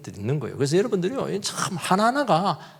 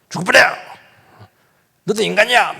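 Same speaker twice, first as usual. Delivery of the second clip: loud, high teasing yell; mock rough joke shout; 7 semitones higher, 5 dB louder than usual. A preacher mimicking Busan jokers, loud teasing yell. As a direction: bark it out like a coarse joke. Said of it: "이래서 하면서".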